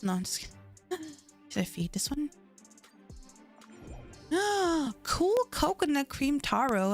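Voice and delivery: usual speaking voice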